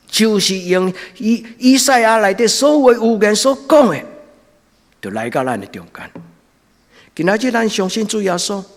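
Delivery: loud, high, impassioned sermon; fiery preaching. Acted. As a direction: preach it out at full force. Preaching at full force.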